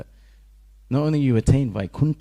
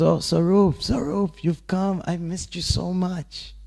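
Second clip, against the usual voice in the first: joyful reunion; 8 semitones higher, slower than usual. Retold or imitated. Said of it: "he goes".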